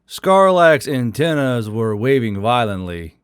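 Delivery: ordinary delivery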